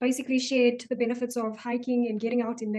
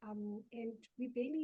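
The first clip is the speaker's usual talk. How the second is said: barely projected, soft delivery; 13 dB quieter than usual. Real, not acted. Co-host continues on busy living, very softly. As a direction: trail along very quietly. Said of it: "You sound very soft".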